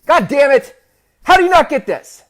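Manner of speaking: yelled high and loud, exploding in frustration; furious outburst. Retold or imitated. Dad losing it at his son over homework, furious yell. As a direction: bark it at a kid who can't follow. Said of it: "And he blew up".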